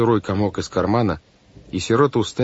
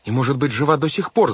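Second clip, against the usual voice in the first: high-pitched hopeful urgency; crying out; 7 semitones higher than usual. A narrator's reading. Girl insists her aunt may live, crying out. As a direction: cry it out, pitched up. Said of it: "закричала Вайолет".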